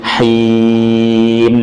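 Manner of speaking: haim held four counts and closed on mim; measured chanted haim; four beats. Shown as a demonstration. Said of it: "jangan berlebihan".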